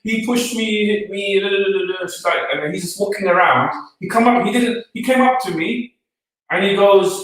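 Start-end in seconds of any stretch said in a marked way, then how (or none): 0.0-2.1 high-pitched mock wail running into babble; complaining woman. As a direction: imitate a woman wailing a complaint.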